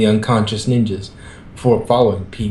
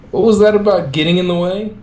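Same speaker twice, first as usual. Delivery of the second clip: light teasing question, pitched higher; 8 semitones higher than usual. A narrator's reading. Woman teasing Red after saving the turtles, playful. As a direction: tease him about getting in the way. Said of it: "she asked Red teasingly".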